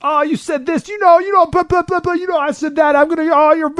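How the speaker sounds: high, shrill mock bickering women; mock catfight yelling. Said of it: "women yelling at each other".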